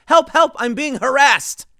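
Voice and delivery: high, loud, slow hammed-up distress call; mock cry for help. Woman pretending harassment, hammed-up cry for help. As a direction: overact a panicked plea. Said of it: "she says".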